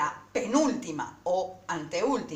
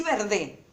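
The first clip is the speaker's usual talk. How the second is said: VERDE stressed on the last syllable, ver-DE; misplaced stress on the DE of VERDE; deliberately wrong stress; 3 semitones higher than usual. Shown as a demonstration. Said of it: "acentuarla mal como hago yo".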